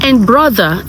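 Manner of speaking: ordinary delivery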